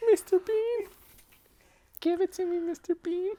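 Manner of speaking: man doing a woman's voice, teasing; girl voice. Acted.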